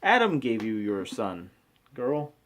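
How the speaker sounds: ordinary delivery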